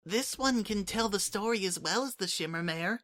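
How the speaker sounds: put-on high, soft childlike changeling voice; a small eager creature offering help; little changeling Kukri. Little changeling Kukri to Sunset, offering gently, high and soft.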